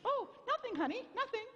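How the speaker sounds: high, meek backing off; timid reply. Pretend. Scared family backing off from mom, high timid squeak. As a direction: squeak out a hasty retreat.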